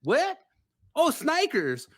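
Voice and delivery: mock clerk's sudden recognition in an American accent; high-pitched caricature of an American accent. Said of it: "putting the American accent to it".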